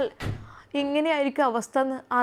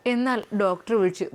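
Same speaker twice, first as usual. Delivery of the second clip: ordinary delivery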